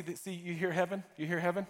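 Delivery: ordinary delivery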